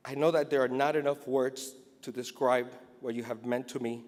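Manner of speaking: ordinary talk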